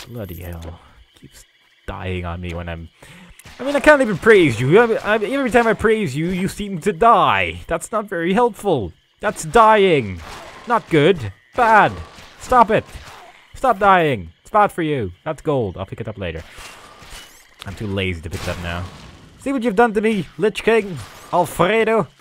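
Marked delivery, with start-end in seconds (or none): 3.6-15.1 high, loud exasperated telling-off; playful, loud exasperation; mock scolding. Scolds minion as if a pet. 19.4-22.1 high, loud playful accusation; mock reproach. Mock blame of the minion.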